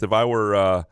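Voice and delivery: ordinary delivery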